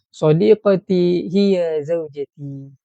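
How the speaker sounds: teacher joking that his friend is his wife; slow playful answer. Shown as a demonstration. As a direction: deliver it slowly like a cheeky punchline. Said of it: "Boleh tak kalau ustaz jawab macam ni?".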